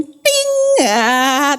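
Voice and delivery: ping like a toothpaste ad's sparkling smile; preacher showing women how to flash a sparkling smile; smile sparkle sound. Shown as a demonstration. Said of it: "berikan senyum".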